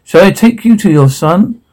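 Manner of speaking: usual speaking voice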